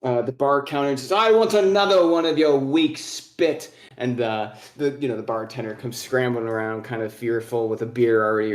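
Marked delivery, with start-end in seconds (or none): 1.1-3.7 raised, higher dwarf voice, loud; demanding old dwarf. Acted. Old dwarf ordering the bartender, loud demanding voice. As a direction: order it like a belligerent old drinker.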